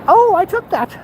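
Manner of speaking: high, mocking proud quote; mock photographer. Mocking photographers admiring their own shots, high mock voice. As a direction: send up the photo hobbyists. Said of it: "and say".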